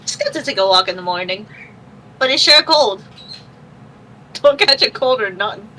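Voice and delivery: put-on loud New York accent character. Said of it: "My New York accent works with this character".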